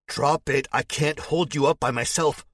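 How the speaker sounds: high, loud, straining yell; desperate yell. A narrator's reading. Narrator pleads loudly with the man dangling over zombies. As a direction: shout it harder, losing grip. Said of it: "I yell".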